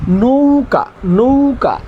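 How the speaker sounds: "নৌকা" with a long nou, then short ka; lengthened diphthong. Shown as a demonstration. Teacher modelling the pronunciation, "নৌকা" with a stretched nou. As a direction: draw out the ou of "নৌকা": nooou-ka. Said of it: "উচ্চারণ হবে".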